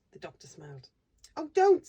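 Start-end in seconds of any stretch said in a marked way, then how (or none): 1.4-1.9 pleading cry. Acted. Bobby pleads with doctor.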